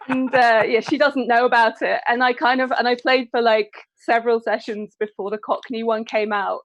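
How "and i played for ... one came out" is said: normal talking voice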